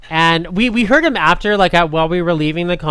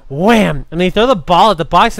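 usual speaking voice

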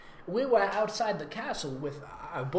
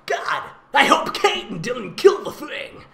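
put-on a higher, louder girl's voice, slowed down; Jessie's voice; 7 semitones higher, 7 dB louder than usual. Reading the sister's line aloud in her voice. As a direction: play the impatient sister, drawn out and loud. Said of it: "That's, that's her voice".

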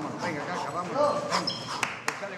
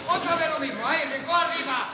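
raised, higher shouted instruction; urgent coaching call; 5 semitones higher, 4 dB louder than usual. Real, not acted. Coach sending boxer back into the drill, urgent call. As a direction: call it out over the sparring.

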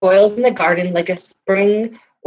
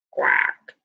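whispered quack; 4 dB quieter than usual. Acted. Duck answers, whispered.

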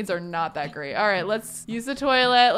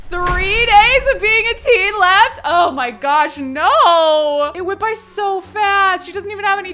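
much higher and louder, slowed dismayed cry; dismayed wail; 9 semitones higher, 9 dB louder than usual. Real, not acted. Realising her girl's teen days are almost over, loud high dismayed wail. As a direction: mourn how fast it went.

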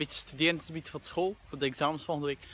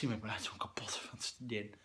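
whispered, worn-out and low; tired whisper; 6 semitones lower, 11 dB quieter than usual. Real, not acted. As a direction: whisper like you're drained. Exhausted after studying.

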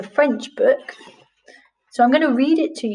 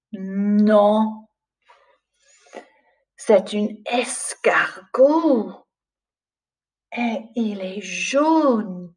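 put-on slow, lilting read-aloud for little children; storybook reading; 2 semitones higher, slower than usual. Teacher reads a French picture book aloud to the class, slow and lilting. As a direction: read it like bedtime to young pupils.

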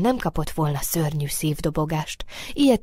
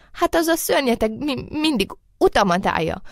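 higher, quavery stammering complaint; chattering with fear; 7 semitones higher than usual. A narrator's reading. Rabbit blames the blocking monster, chattering fearfully. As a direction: stutter as though shivering with fright. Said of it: "vacogta ismét a Nyuszi".

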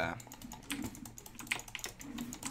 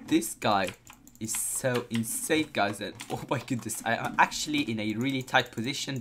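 ordinary talk

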